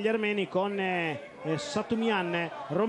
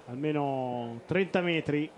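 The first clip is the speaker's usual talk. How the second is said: usual speaking voice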